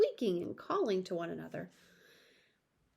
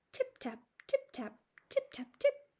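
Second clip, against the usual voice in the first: tiny tapping. A narrator's reading. Mice tapping under teacups.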